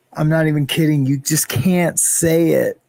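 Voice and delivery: ordinary talk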